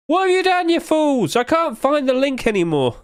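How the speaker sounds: high-pitched hammed-up wail; mock outrage. Streamer blames site for losing the link, high mock outrage. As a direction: wail at them in a high voice, dropping towards the end.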